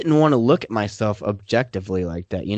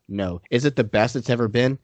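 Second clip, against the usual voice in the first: ordinary talk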